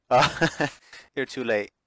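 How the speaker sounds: loud, frantic cry; desperate shout. Acted. Ashley warning the hero, loud and desperate. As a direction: shout it in despair. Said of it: "Ashley shouted".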